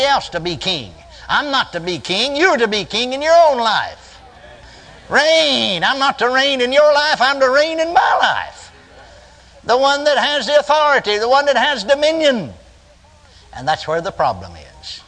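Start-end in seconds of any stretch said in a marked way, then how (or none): 2.3-12.5 high, emphatic pulpit declaration; forceful preaching. Real, not acted. Preacher telling listeners to reign in their own lives, forceful preaching. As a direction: drive it home in a raised preaching voice.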